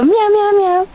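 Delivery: high, loud cat meows; hammed-up affectionate cat noises; playful meowing. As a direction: meow loudly in a silly high pitch. Acts out cheesy affection, meowing.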